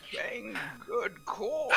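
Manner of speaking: put-on slow, creaky old man. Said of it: "change your timber to be an old man".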